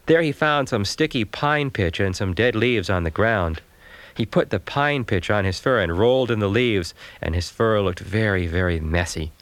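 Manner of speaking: usual speaking voice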